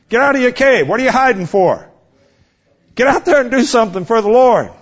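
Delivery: insistent sermon call; exhorting preacher. Acted. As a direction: push them out of the cave. Exhorting the congregation.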